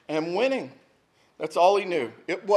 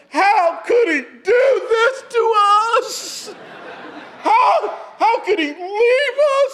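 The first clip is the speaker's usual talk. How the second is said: high, loud hammed-up wailing through laughter; laughing mock-cry of an eleven-year-old; 5 dB louder, much slower than usual. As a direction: imitate a heartbroken kid sobbing, much higher. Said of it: "he cried out".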